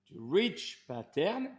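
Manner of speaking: slow, self-mocking English accent on reach pattern. Coach saying reach pattern to his viewers, mock English accent. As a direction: put on a jokey English accent, slowly. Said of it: "avec mon superbe accent anglais".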